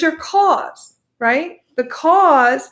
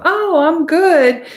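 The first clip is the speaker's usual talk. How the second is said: feigned reassurance; 5 semitones higher, 4 dB louder, slower than usual. Acted. Self-deceiving story being acted.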